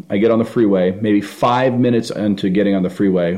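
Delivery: normal talking voice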